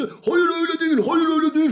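high, loud chorus of no; protesting shout. Retold or imitated. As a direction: shout them down, indignant. Classmates loudly objecting in class.

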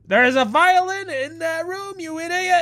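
high-pitched mock yell at an idiot; hammed-up outraged viewer scolding him; mocking angry commenter. Streamer imitating viewers yelling at him in comments, hammed-up high mock yell. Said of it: "They're gonna be like".

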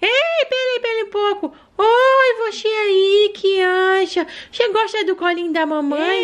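put-on high childlike voice of a small daughter; little girl voice. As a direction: play the sick little girl talking to mom. Doll addresses viewers.

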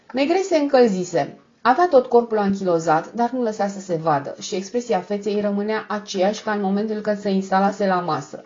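ordinary delivery

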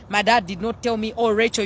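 usual speaking voice

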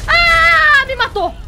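high, loud scream, dropping into words; alarmed scream. Real, not acted. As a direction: scream as you get killed. Killed in the game.

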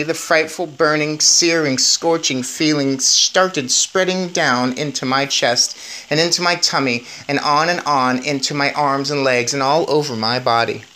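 ordinary delivery

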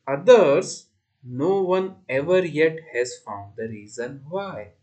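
measured verse in short, separated phrases; poem recitation. Shown as a demonstration. Teacher reciting the last stanza to pupils, measured recitation. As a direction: recite it carefully, pausing after each phrase.

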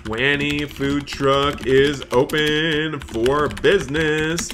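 slow, showy grand-opening call; announcer voice. Acted. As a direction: proclaim it like a ringmaster. Grand opening of the food truck.

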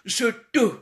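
mock "je te" as zhuh-tuh; "je te" with put-on schwa vowels; changed accent. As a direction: mimic his affected French, both vowels rounded in "je te". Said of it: "moi, accent changea".

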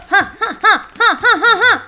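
high staccato "ja ja ja"; mock triumphant cackle; gloating laugh. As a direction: laugh in mischievous separate beats. Gloats while escaping Glitch.

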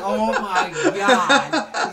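stretched out, laughing; slow laughing disbelief. Retold or imitated. Host reacting to his friend's promo, laughing slowly. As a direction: groan it out while cracking up. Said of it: "And I'm like".

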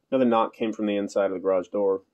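plain reading voice

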